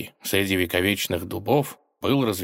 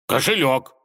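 loud, higher, insistent reply; exasperated; 6 semitones higher, 5 dB louder than usual. A narrator's reading. Robber losing patience with the tsar, exasperated. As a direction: insist on it with fists clenched. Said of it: "сжал кулаки разбойник".

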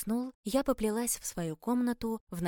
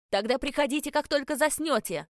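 raised, louder parting call; urgent shout; 6 semitones higher, 6 dB louder than usual. A narrator's reading. Captain calls to vanishing heroine to return, shouting. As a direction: shout after someone being pulled away. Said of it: "крикнул капитан".

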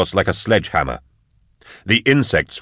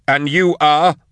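high, raised questioning cry; questioning character voice; 8 semitones higher, 3 dB louder, slower than usual. Acted. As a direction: throw the question back, pitched high. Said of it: "asked Drusha".

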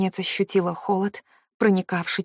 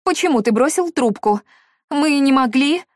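frightened voice; 6 semitones higher, 5 dB louder than usual. A narrator's reading. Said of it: "раздался испуганный голос".

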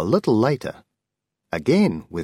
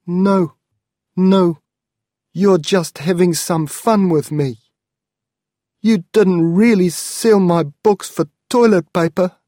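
higher, louder protest, refusing to believe it; a shocked young man pleading with his aunt; disbelieving nephew; 12 semitones higher, 9 dB louder than usual. Acted. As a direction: insist it must be a joke, voice raised.

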